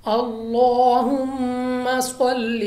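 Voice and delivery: slow, melodic, drawn-out chanting of Arabic; Quranic recitation. Shown as a demonstration. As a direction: recite with long held vowels and tajweed. Teacher recites Durood demo.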